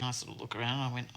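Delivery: ordinary talk, nothing marked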